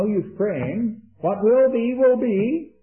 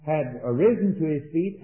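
normal talking voice

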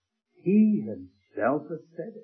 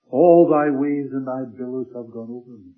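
forceful recitation; 10 dB louder than usual. Acted. Quoting the psalm with force.

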